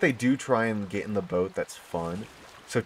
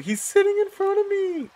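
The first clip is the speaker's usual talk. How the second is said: very high, thrilled squeak; giddy, high-pitched exclamation; excited squeal; 3 dB louder than usual. Real, not acted. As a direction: squeak with delight. Thrilled creature sits before her.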